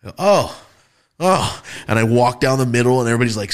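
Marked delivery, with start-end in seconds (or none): 0.2-1.6 startled oh. Retold or imitated. Startled when called forward.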